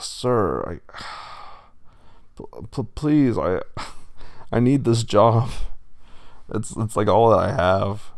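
halting plea broken by sighs; desperate pleading. A narrator's reading. Wolf begs bull boss for job, desperate. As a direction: stammer and beg, voice rising on the plea.

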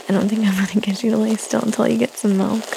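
fast tired whisper. Real, not acted. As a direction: whisper it quickly after a sigh. Sighs, whispers while baby fusses.

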